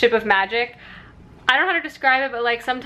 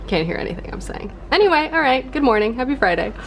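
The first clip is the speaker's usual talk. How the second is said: ordinary talk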